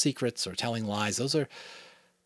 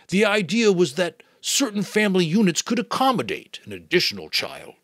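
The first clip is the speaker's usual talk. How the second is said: the giver, explaining; 9 semitones higher, 8 dB louder than usual. Acted. Giver figure speaks.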